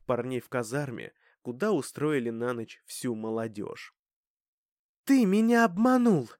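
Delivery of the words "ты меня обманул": loud, high accusing cry; angry accusation. Acted. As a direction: hurl the accusation at him. Dasha accuses Klim on arrival.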